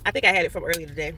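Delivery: fast, low muttered aside; quick low aside. Real, not acted. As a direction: rush it low under breath. Quick aside about water.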